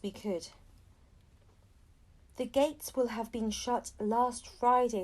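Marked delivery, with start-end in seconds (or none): none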